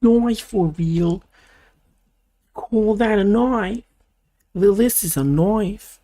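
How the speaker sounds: slow, drawn-out hammed-up Australian movie hero; put-on Australian accent. Vlogger showing off his knife, slow hammed-up Australian accent. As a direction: drawl it like an outback tough guy showing his blade.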